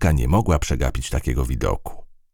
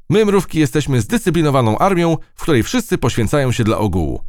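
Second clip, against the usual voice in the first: high-pitched military declaration; ant soldier; 11 semitones higher, 4 dB louder than usual. Acted. Ant extols its disciplined army, high soldier voice. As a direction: proclaim it as a tiny loyal soldier.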